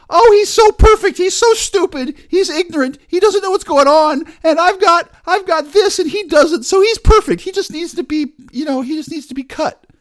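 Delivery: high, loud sneering mimic of a critic; mocking critic voice. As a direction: squeal it out like a snide know-it-all. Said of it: "some person who says".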